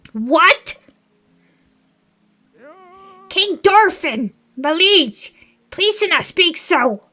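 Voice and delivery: put-on high, loud, outraged old Zora; indignant old advisor voice. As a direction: protest shrilly to the king. Streamer voices Muzu.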